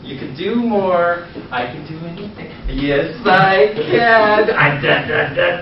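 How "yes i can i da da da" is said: put-on loud, slurred drunk singsong; acting drunk. Shown as a demonstration. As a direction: babble like a happy drunk. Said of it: "I like to act drunk".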